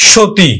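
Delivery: সতী said "sho-ti", with sh and a short i; brisk teacher's "sho-ti" for সতী. Shown as a demonstration. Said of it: "রস্বইকার উচ্চারণ করি".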